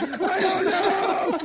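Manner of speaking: very high, slow helpless cry; panicked mock wail. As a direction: stretch it out in hammed-up panic. Panicked father answers wife.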